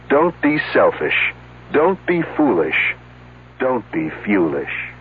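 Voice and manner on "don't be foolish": ordinary talk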